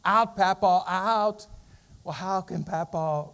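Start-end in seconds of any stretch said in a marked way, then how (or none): 0.0-1.5 put-on high, loud little child begging; pleading toddler. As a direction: plead like a tiny boy in a playpen. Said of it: "he begins to plead".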